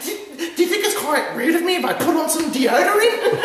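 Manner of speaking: very high, loud, frantic squeal; hammed-up excitable traveler, laughing through it; imitated Aussie woman. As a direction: gush at a stranger in a piercing mock falsetto. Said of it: "Aussie woman that hopped on the plane".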